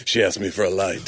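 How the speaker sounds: hard-boiled fifties detective voice; deadpan film noir gumshoe narration. Pretend. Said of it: "like a fifties film noir detective".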